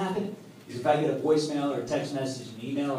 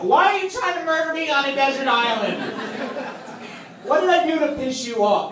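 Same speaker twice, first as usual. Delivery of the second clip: loud, high, indignant yelling; high-pitched, aggrieved shouting; exasperated outburst; 10 semitones higher, 5 dB louder than usual. Acted. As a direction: blow up at an impossible date. Acted quarrel over desert island game.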